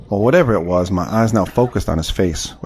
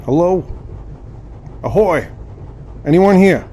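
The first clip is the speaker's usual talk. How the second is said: calling out; 8 semitones higher, slower than usual. Acted. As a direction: shout to be heard on a windy deck. Calling out on windy deck.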